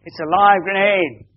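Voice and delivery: high, loud acted yell; yelled warning. Soldiers tricking Ben with a grenade warning, yelling. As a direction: yell out the alarm as storytelling. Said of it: "yelled out".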